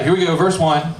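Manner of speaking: normal talking voice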